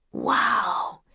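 breathy hushed wow; whispered wow. Acted. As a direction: breathe it out in awe. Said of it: "said Jack and Annie together".

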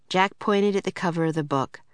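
plain reading voice

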